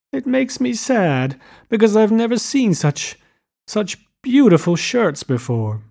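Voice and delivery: high tearful voice, halting midway; sobbing, as narrated. A narrator's reading. Daisy explaining her tears over the shirts, acted sobbing. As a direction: act weepy, catching on the repeated word. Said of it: "she sobbed".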